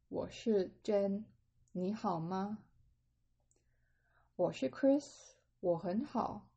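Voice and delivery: slow, carefully spaced Mandarin dialogue; slow read-along. Shown as a demonstration. Teacher reading a conversation for learners to read along, slowly. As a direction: read it slowly, leaving gaps to follow. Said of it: "I'll read it quite slowly".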